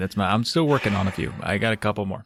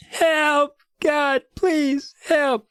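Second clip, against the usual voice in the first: much higher, strained begging; mock terrified plea; 13 semitones higher, much slower than usual. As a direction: squeal for help in mock panic. Covered in bees, begging.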